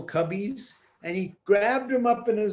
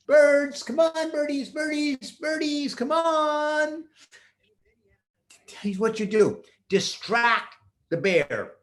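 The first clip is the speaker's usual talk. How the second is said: calling out; 6 semitones higher than usual. Acted. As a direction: call the birds over. Peter calling the birds.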